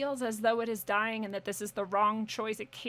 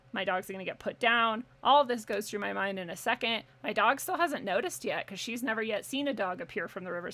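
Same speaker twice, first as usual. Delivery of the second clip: usual speaking voice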